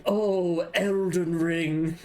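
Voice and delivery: high, booming, drawn-out narrator voice; trailer narrator impression. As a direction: intone it like an epic game-trailer narrator. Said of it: "Pretty good impression".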